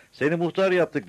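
high, loud call from below; shouted announcement. A narrator's reading. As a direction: shout it up to the rooftop. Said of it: "diye bağırdı".

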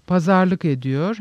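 ordinary delivery

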